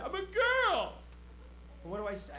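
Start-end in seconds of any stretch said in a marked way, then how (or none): 0.0-0.9 put-on high, whining teen girl; mock teenage whine; daughter's whiny voice. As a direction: play the bratty daughter, pitched way up. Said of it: "That was it".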